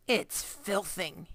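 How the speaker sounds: slow, spluttered through food; sputtering, mouth full. A narrator's reading. Giant sputtering with a mouthful of Snozcumber. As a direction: spit the words round a mouthful. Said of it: "he sputtered, speaking with his mouth full".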